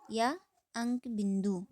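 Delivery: ordinary talk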